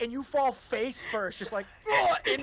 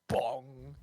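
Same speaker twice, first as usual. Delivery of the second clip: chest thump sound; 8 semitones lower, 4 dB quieter than usual. Acted. Chest smash sound effect.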